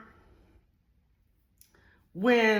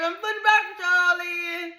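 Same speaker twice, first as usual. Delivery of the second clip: hollered out; 14 semitones higher, 3 dB louder, faster than usual. Retold or imitated. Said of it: "she hollered it out".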